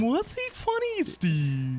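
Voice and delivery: very high, drawn-out odd voice; put-on comedian voice. Mimicking an odd comedian's act, slow falsetto. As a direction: stretch it out in a squeaky falsetto. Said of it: "I bet he doesn't talk like this".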